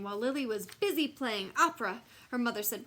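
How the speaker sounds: ordinary delivery, nothing marked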